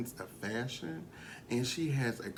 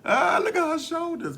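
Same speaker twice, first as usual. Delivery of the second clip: loud exclaim; 8 dB louder than usual. Real, not acted. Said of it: "loud exclaim".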